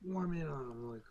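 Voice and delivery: usual speaking voice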